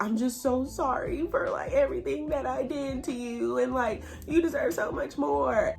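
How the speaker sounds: lightly mock-tearful pleading; retold tearful apology. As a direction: put on a mildly weepy voice. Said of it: "He's sitting there crying".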